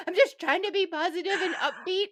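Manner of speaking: mock toddler voice. Teasing a friend, mimicking him as a whiny toddler. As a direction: tease him like a sulky toddler. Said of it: "He's like".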